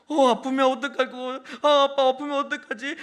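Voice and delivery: loud, high-pitched frightened wail; imitated crying child. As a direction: wail like a kid dreading a tooth pull. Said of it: "막 우는 거예요". (무서워서 우는 아이 흉내)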